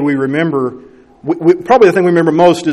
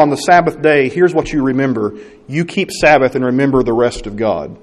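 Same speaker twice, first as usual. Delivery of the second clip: ordinary delivery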